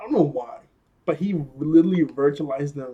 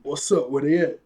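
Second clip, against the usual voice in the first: imitated swaggering crab, rising on the repeat; mock tough swagger; 5 dB louder than usual. Crab walks up so hard, mock tough swagger. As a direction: play a crab strutting in, building louder. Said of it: "he just walks up so hard".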